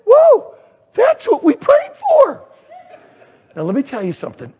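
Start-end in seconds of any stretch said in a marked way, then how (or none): 0.1-2.4 high, loud triumphant exclamation; amazed excitement. Retold or imitated. Husband realizing a prayer was answered, amazed excitement. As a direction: cry out in high-pitched wonder.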